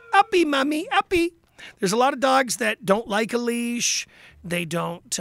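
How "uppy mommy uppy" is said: put-on high, whiny little baby plea. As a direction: beg like a toddler wanting up. Voicing the whiny dog.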